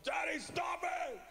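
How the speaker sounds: high, drawn-out shout; exasperated mock child scream; angry daughter. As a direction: imitate a child yelling at a parent. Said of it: "the more angry she would get".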